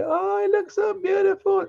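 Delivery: high, gushing fake admiration; mocking sugary coo. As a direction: gush like a shallow pet fan. Mocking shallow animal lovers.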